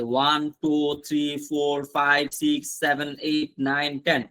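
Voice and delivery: very slow, spaced-out count from one to টেন; teacher counting slowly for beginners. Shown as a demonstration. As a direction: count each number slowly for the class. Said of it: "one গুলো পরিচয় করতে হবে".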